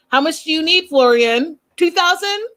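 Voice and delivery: high-pitched, rising questions; lightly mocking high offer of cash; imitating Stacy. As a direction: mimic her in a high voice. Voices Stacy asking Florian.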